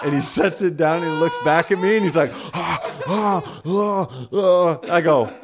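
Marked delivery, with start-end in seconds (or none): 2.3-3.7 high, whimpering mock gasps; repeated wheezy gasps, hammed up; imitated pained gasps. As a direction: act out someone gasping from burning salsa. Imitates man gasping at him.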